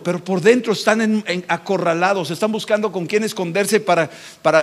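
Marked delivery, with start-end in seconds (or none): none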